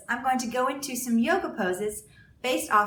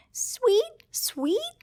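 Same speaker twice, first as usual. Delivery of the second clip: high chirping tweets; bird chirp; 13 semitones higher than usual. Acted. A teacher voicing Bird in a story, high chirp. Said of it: "said Bird".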